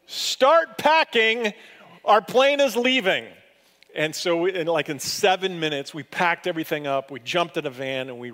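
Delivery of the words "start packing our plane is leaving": loud, high urgent call; yelled out. Retold or imitated. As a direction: yell it across the house to your wife. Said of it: "So I yelled out to my wife".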